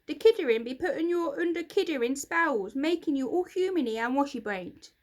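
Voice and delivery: cross little creature ranting; grumpy creaker voice. Acted. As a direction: sneer about human magic.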